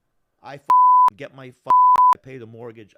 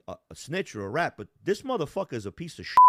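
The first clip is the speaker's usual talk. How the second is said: usual speaking voice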